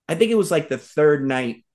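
usual speaking voice

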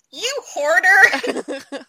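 high, drawn-out retold jab; slow, high-pitched mock scolding; mock accusation. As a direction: stretch it out slowly and high, imitating an accuser. Retells man's teasing accusation.

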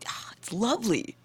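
soft, high swoon of delight; dreamy relish. Real, not acted. Fan savouring good blood splatter, dreamy relish.

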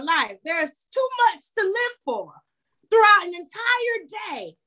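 grandmotherly voice. Acted. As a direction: lift the pitch and coax them like a loving grandmother. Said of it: "So whenever I'm speaking like that".

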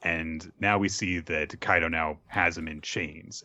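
ordinary delivery

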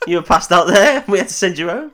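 playfully telling off Alan; mock scolding.

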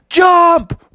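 high, sudden yelled command; storybook battle cry. Acted. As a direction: yell it high as the bear leader. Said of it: "Bubby yelled".

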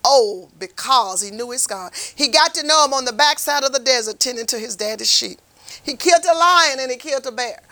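ordinary delivery

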